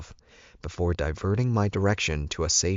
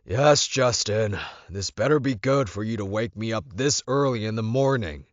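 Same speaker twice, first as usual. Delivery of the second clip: irritated bark; 5 semitones higher than usual. A narrator's reading. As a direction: bark it like a boss dragged out of bed. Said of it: "Alpha Roddy barked".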